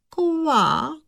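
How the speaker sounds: high, rising haughty croak; puffed-up frog. A narrator's reading. Vain frog princess shows off to her suitor, puffed-up croak. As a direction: puff yourself up and croak high. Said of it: "Nadýmala se jen a říkala".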